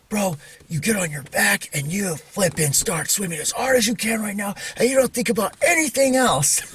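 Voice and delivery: high-pitched, urgent retold order; really serious, swearing. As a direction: act out swearing at your brother to keep going. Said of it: "I got really serious with him".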